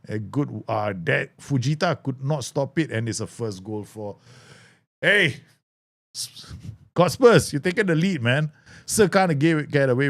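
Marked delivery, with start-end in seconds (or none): 5.0-10.1 excited outburst. Real, not acted. Reacting to a goal in game.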